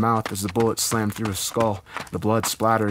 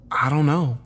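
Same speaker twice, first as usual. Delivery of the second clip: higher-pitched character voice, admitting doubt; lightly voiced woman, pitched up; admitting uncertainty; 7 semitones higher, 4 dB louder than usual. A narrator's reading. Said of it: "Heather admitted".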